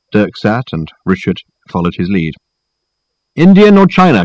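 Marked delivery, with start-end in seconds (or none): 3.3-4.2 high, loud called question; calling out. A narrator's reading. Reg asking guests about tea, calling out. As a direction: pitch it through to the next room. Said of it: "called Reg".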